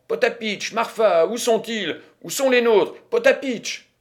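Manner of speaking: high, loud, impatient calls; calling out. A narrator's reading. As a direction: summon your servants urgently. Calling servants for her winnings.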